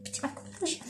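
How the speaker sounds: whispered aside; breathy under-breath aside; quick whispered remark to viewers. Real, not acted. Whispered aside about makeup.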